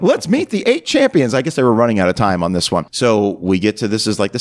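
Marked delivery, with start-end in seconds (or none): 0.0-1.3 mock announcer. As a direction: announce the contestants grandly. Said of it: "He's like".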